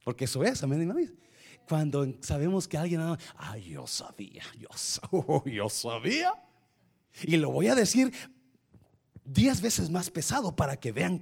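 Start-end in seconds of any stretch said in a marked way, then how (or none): 3.4-6.3 a knowing, gloating little aside with a laugh; mock gloating gossip. Imita a quien se alegra del error. (chisme burlón en voz baja, con risita)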